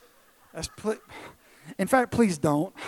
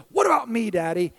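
put-on loud, higher kid interruption; demanding little son; 5 semitones higher, 6 dB louder than usual. Son interrupting dad's talk with brother, loud needy demand. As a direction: burst in as an insistent child. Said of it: "David just come over".

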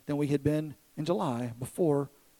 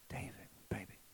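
softly whispered, close and gentle; tender whisper; 3 semitones lower, 18 dB quieter than usual. Retold or imitated. Father whispering to his son held close, softly. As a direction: breathe his name like a secret.

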